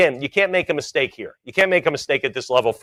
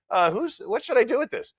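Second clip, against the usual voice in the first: imagined puzzled finder; 10 semitones higher than usual. Retold or imitated. Voicing finder in hallway.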